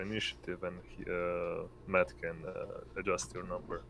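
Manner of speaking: ordinary talk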